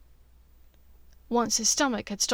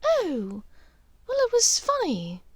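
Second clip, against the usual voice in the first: higher woman's voice, brushing it off; lightly dismissive in a raised voice; Narcissa voice; 10 semitones higher than usual. Acted. Draco as Narcissa, deflecting Lucius with a higher woman's voice.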